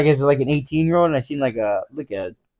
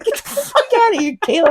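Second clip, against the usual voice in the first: high, laughing retold protest; high-pitched protest. Telling Kayla to get out during the scuffle, high-pitched protest. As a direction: squeal it as a mock brush-off.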